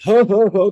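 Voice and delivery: exaggerated boo-hoo sobs, high and loud; mock sobbing. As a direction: fake a big heaving cry in bursts. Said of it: "crying like that".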